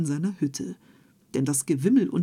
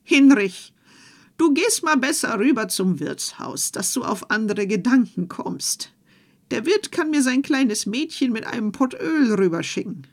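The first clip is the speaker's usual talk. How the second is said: higher, louder, brisk wife; a lightly voiced wife, high and insistent; wife's voice; 4 semitones higher, 5 dB louder than usual. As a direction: urge your husband out of the house. Wife voiced sending husband off.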